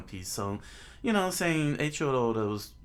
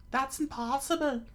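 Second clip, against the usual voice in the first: high falsetto exclamation; high, incredulous character voice; disbelieving young woman. Acted. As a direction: protest in a put-on female voice. Nami reacts in disbelief.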